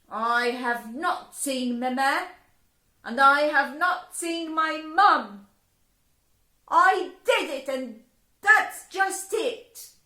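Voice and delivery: loud, high defiant answer; loud, high girl defying fairies. Acted. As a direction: stand her ground.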